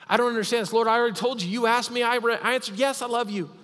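high, strained, loud plea; exasperated pleading. Acted. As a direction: press the point, voice climbing high. Peter's imagined frustrated plea.

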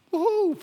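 short high-pitched whoop. Real, not acted. Pastor cheering as the congregation stands, high whoop. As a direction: let out a quick, high "woo-hoo".

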